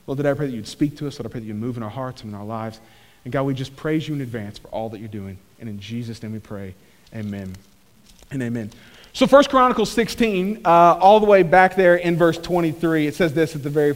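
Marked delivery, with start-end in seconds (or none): 0.1-8.7 low, quiet prayer voice; hushed, low-pitched prayer winding down to amen; closing prayer. Real, not acted. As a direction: pray softly and reverently, well under full voice.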